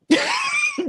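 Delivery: high burst of laughter; shrieking laugh. Real, not acted. Cohost cracking up over the clip, shrieking laugh. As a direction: let out a sudden shriek of a laugh.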